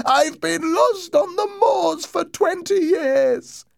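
slow, very high, sobbing wail; crying ghost voice. A narrator's reading. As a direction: wail it slowly like a lost spirit. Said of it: "the voice cried".

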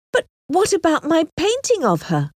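high-pitched puzzled question; baffled, searching query; confused. A narrator's reading. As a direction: ask in bewilderment, pitch lifted.

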